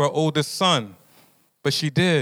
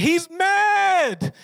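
mad, stretched; 13 semitones higher than usual. Real, not acted. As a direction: stretch out mad with a big cry. Narrating Esau's anger.

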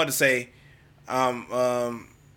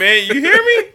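high, loud laughing holler; excited holler; 12 semitones higher, 9 dB louder than usual. Retold or imitated. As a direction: holler it out, pitched up. Gawking quote.

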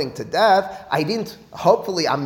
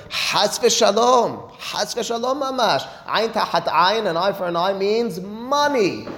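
high, slow, drawn-out protest; emphatic rejection; 6 semitones higher, slower than usual. Acted. Teacher voicing Harambam's ruling to the class, emphatic rejection. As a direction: stretch out the exclamation in a raised pitch. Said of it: "Says Harambam in his Mishneh Torah".

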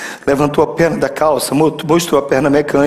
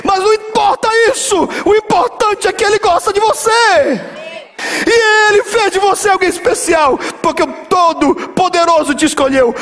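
high, impassioned preacher's cry; pregação exaltada; 13 semitones higher than usual. Real, not acted. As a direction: proclaim it at full force, pitch way up. Pregador exalta, clímax da mensagem. (pregação exaltada, voz bem aguda)

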